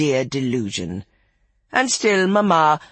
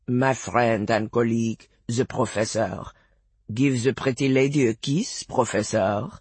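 put-on lower-pitched French-accented charmer; charming French accent; 5 semitones lower, 3 dB quieter than usual. A narrator's reading. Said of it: "in a charming French accent".

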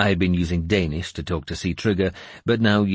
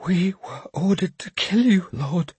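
high, thin, scared confession; timid young man's high-voiced admission; frightened whisper; 12 semitones higher than usual. A narrator's reading. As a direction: confess it in fear, pitched far up. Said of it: "frightened man whispered the words".

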